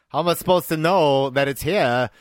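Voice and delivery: lilting, sing-song celebrity parody; Christopher Walken. Acted. Emperor complaining about the scroll, comic actor impression. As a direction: mimic the actor's odd cadence. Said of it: "This is the Christopher Walken scene?".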